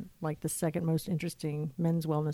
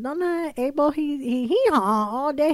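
high, louder, slow mock mother braying; mother imitating donkey; 8 semitones higher, 5 dB louder, slower than usual. Mother calling daughter about donkey, mock bray. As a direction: bray out he haw like an old lady. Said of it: "she said".

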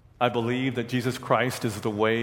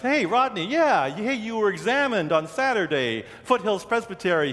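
higher, bright-eyed hello to a friend; excited greeting; 7 semitones higher than usual. Retold or imitated. Pastor greets Rodney, excited and higher. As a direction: call out happily to Rodney.

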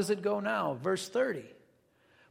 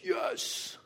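relieved yes; 11 semitones higher, 7 dB quieter than usual. Retold or imitated. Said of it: "He's like".

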